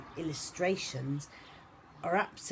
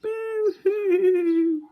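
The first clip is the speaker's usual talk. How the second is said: high, loud mock boo-hoo echo; 11 dB louder than usual. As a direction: answer like a far echo. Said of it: "said a voice from across the pond".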